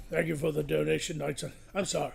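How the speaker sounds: usual speaking voice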